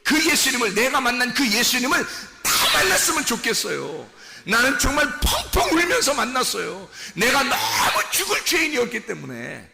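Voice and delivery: high, loud pulpit testimony; impassioned preaching. Real, not acted. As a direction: pour out your testimony with urgency. Preaches personal testimony at full force. (간절하게 외치는 간증 설교)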